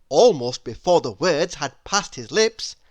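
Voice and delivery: plain reading voice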